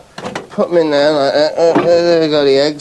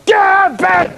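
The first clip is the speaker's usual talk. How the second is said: mock shout. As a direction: yell it up at the bedroom window. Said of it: "go around their house and go".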